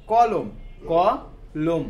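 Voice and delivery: কলম said loud, then split as ক and লোম; teacher splitting কলম into syllables. Shown as a demonstration. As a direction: break কলম into ক, লোম clearly. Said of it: "ক তো আমরা অনেকক্ষণ ধরে টানতে পারবো".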